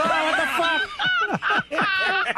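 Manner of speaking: drawn-out high exasperated laugh, slow; lost driving round the block, drawn-out laughing. Retold or imitated. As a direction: stretch it out in laughing disbelief. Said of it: "I'm like".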